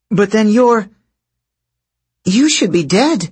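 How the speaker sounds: stunned as it dawns on her; shocked realization. Acted.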